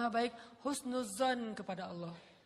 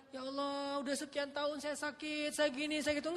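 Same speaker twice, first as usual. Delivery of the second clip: whiny, higher grumble to God; complaining prayer; 5 semitones higher than usual. Shown as a demonstration. Ayub praying the wrong way, mock complaining. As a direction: list your woes like a complaint.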